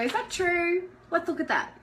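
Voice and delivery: gentle mum. Retold or imitated. Mum answering her upset kids, patient and gentle. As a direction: ask kindly, inviting them to look. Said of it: "and I go".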